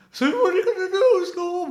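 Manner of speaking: very high, loud cartoon voice. Demonstrating one part of a three-way impression, high loud cartoon voice. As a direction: belt the catchphrase in a squeaky pitch. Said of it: "you've got to do the".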